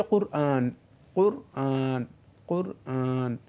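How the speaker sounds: "কোরআন" as Qur-aan, u in the first syllable, long aa; "কোরআন" said the Arabic way, Qur'aan; correct Arabic pronunciation. Shown as a demonstration. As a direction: model "কোরআন" in two separate parts, Qur, aan. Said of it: "এটা হচ্ছে শুদ্ধ".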